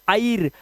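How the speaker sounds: "आयर", starting on "aai" instead of "ay"; wrong air vowel. Shown as a demonstration. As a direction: say "आयर" with an "aa-i" glide. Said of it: "आई की आवाज नहीं".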